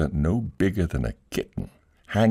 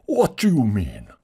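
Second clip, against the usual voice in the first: higher, louder demand; outraged tragedian; 5 semitones higher, 4 dB louder than usual. Acted.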